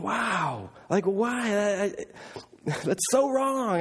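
retold shock, high-pitched and halting; appalled disbelief. Recalling his thoughts at the mother's tirade, appalled disbelief.

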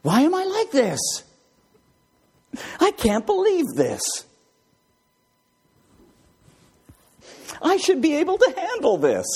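a touch of a fed-up perfectionist; exasperated self-talk. Acted. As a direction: whine at yourself in disbelief. Voicing frustrated inner thoughts.

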